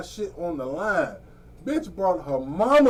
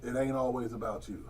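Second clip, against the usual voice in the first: much lower and softer, laid-back put-down; low, soft aside; 9 semitones lower, 13 dB quieter than usual. Retold or imitated. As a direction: say it low under your breath. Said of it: "I be wanting to say".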